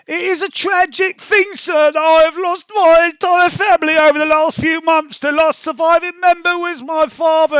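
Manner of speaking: put-on high, tragic little-boy voice; lost child voice. Rogue telling the guard his tragic story, high voice. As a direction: play the mourning orphan. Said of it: "I'm gonna say".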